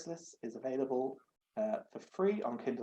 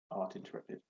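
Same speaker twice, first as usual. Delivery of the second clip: usual speaking voice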